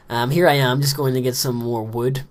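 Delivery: ordinary talk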